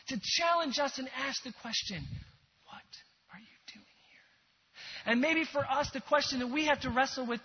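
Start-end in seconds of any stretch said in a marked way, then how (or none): 2.6-4.3 soft unvoiced whisper; delicate whisper. Shown as a demonstration. Preacher voicing God speaking delicately to us. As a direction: breathe the question delicately. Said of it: "speaking delicately".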